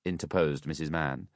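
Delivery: ordinary delivery, nothing marked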